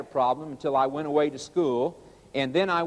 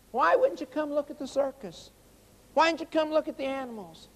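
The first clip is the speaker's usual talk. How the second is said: high-pitched imitation of baffled kids; retold childlike disbelief, pitched up; puzzled schoolchildren pleading; 10 semitones higher than usual. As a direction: ask like a kid who can't understand why. Retold children questioning the teacher.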